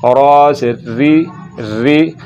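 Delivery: slow "रा से री" ending on a light "री"; thin the R of "री", tongue forward; light, fine "री" after a full "रा". Shown as a demonstration. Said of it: "बारीक पढ़ना है".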